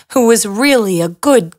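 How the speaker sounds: ordinary delivery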